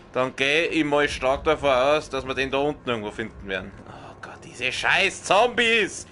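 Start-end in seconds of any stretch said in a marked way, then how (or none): none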